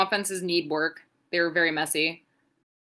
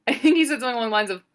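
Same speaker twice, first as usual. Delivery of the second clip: ordinary delivery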